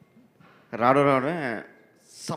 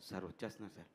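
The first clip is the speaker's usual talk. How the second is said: very soft, lower-pitched reply; cowed assistant; 6 semitones lower, 15 dB quieter, faster than usual. Retold or imitated. Assistant tells the angry director he understood, cowed. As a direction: mimic a junior meekly giving in.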